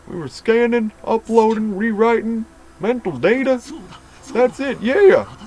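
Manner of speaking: high, loud rush of recovered memory; ecstatic realization. Acted. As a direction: ride the surge of memories to a cry. Memories flooding back to patient.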